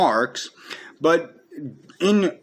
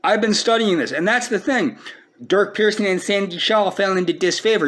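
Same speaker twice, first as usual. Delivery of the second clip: ordinary talk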